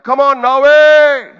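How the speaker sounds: loud, slow, high cheer; shout. Retold or imitated. Lone photographer shouting for Norway's boxer at ringside, a loud cheer. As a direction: yell for the boxer across the hall. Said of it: "So I shouted".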